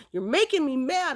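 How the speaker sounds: high, mildly mad outburst; retold anger. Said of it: "I was getting mad".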